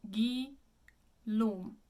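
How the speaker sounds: "Guillaume" slowly split as ghee-LOHM, closed O; correct French. Shown as a demonstration. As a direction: model French "Guillaume" syllable by syllable, no W. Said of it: "it is".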